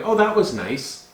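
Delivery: high-pitched, lukewarm compliment; mock a guest being politely nice; soft polite praise. Host imitating underwhelmed wedding guests leaving, mock polite praise. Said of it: "They use really soft words".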